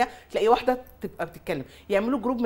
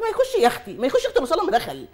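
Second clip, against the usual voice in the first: mock exasperated retort; 6 semitones higher, 7 dB louder than usual. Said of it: "قلت لها".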